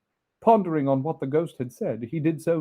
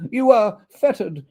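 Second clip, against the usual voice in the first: trembling; 9 semitones higher, 9 dB louder than usual. Acted.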